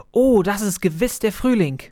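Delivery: hopeful chirp of a little sparrow; hopeful little bird. Acted. Freezing bird thinks spring has come, hopeful small voice.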